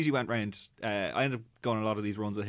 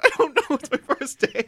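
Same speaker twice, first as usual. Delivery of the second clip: very high, loud mock-panicked yell; hammed-up flustered excuse; panicked protest; 14 semitones higher, 8 dB louder than usual. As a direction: squeal it out in comic helplessness. Acting out his panicked reply.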